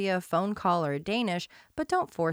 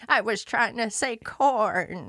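put-on shaky old voice; 4 semitones higher, 4 dB louder, slower than usual. Shown as a demonstration. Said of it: "What word were you trying to say".

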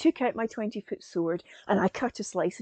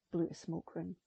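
plain reading voice

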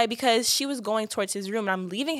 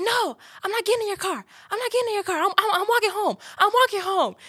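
dramatic high refusal; 11 semitones higher than usual. Retold or imitated. Refusing to get in car.

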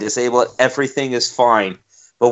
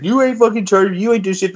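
higher-pitched indignant yelling; mock indignant protest; 9 semitones higher than usual. Homeowner arguing with the police at his door, mock outrage, higher. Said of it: "he's like".

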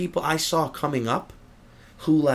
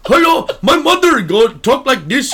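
high, loud mock Indian accent voicing the comic's joke; hammed-up Indian accent, pitched up; 11 semitones higher, 9 dB louder than usual. Comedian mocking a bad comic's joke, in a loud mock Indian accent. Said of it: "those were jokes he had".

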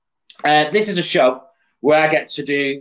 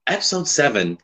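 normal talking voice

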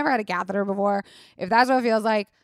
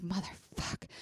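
muttered curse; 14 dB quieter, slower than usual. Retold or imitated. Can't pee, quoted curse.

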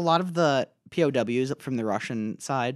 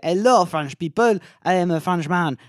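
higher-pitched mock French accent; 4 semitones higher, 5 dB louder than usual. Greeting the people of France, hammy French accent. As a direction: play a cheery fake Frenchman. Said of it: "I also have my French accent".

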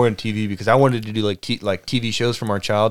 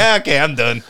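mock dirty talk; 3 semitones higher than usual. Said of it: "talking dirty to her".

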